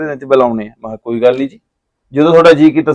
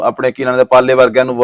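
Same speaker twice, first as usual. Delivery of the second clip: ordinary talk, nothing marked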